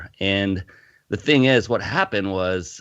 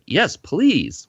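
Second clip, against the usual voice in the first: eager; 10 semitones higher, 4 dB louder than usual. Retold or imitated. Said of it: "I said".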